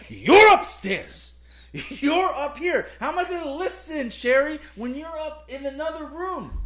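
high, slow exasperated pleading. Real, not acted. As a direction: plead your case, fed up. Exasperated defense, arguing.

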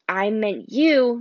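"I meant you" drawn out slowly, "you" leaned on; stress on you. Acted. Friend explaining he meant anyone, stressed slow you. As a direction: correct him patiently, slowly. Grover clarifies generic you.

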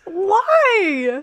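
high, drawn-out protest; incredulous, high-pitched objection; protesting disbelief. Real, not acted. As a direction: stretch it out in playful dismay. Protesting co-host's dislike of contestant.

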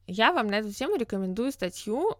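normal talking voice

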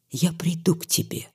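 soft unvoiced whisper. A narrator's reading. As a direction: breathe the promise as she leaves. Parting promise to the doctor.

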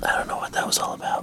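whispered, mock-puzzled; whispering. Real, not acted. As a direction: whisper it, a bit baffled. Whispered banter.

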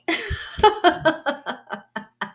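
long high peal of laughter, tailing off; real, drawn-out belly laugh. Real, not acted. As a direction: crack up at your own punchline. Laughs at her own punchline.

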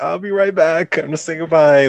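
high, singsong movie-quote voice; acted fib. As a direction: lightly mimic a character stalling visitors. Acting Carl fobbing people off.

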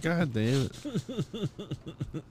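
hammed-up disappointed groan after the ruined night; mock despair. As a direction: let it sag out in defeat. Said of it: "I was like".

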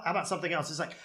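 usual speaking voice

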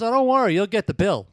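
snide comeback. Retold or imitated. Son mocking his father over the torn sleeve, high and loud. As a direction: throw it back at him with a sneer, high and loud.

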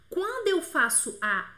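normal talking voice

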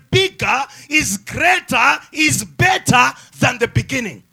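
emphatic preacher, key words punched loud; full-force preaching. Real, not acted. Preacher tells congregation the end is better, emphatic preaching. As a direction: drive each phrase home from the pulpit.

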